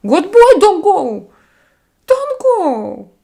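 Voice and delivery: high, loud coaxing as if to a dog; pleading baby talk. Acted. Streamer begging a departing viewer to stay, in high pleading baby talk.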